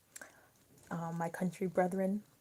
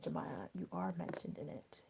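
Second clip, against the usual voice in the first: soft whisper, lower; whispered aside; 4 semitones lower, 7 dB quieter than usual. Real, not acted. Whispering to Jemiah that she got mentioned. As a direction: breathe it like a secret.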